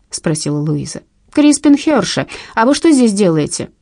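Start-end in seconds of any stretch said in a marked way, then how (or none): 1.3-3.7 put-on high, loud child voice; little boy. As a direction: play a small boy guarding his spot. Little boy questions two women.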